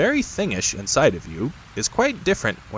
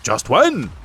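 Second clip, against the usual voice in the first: uncomfortable Tigger; 6 semitones higher, 5 dB louder than usual. Acted. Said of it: "said Tigger a little uncomfortably".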